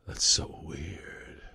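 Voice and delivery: slow, low disbelieving whisper; hushed judgement. Retold or imitated. Pellet lady judging his small family, in a hushed whisper. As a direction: whisper it slowly, drawing out each word.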